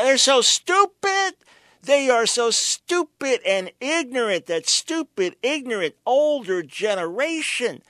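mock the kid's contempt for the old; sarcastic mockery. Host mocks teen's view of parents.

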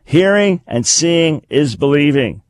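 normal talking voice

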